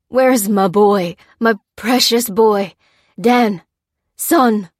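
loud, slow, delirious calls; feverish shouting. A narrator's reading. Feverish father calling for his son, loud delirious shout. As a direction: shout for the son who isn't there. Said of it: "called Adrian, shouting at something unseen".

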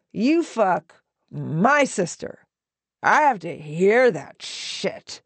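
slow, emphatic, rising accusations; scornful, deliberate anger; angry tirade. A narrator's reading. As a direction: throw each question at him, drawn out. Otto rages at Peter.

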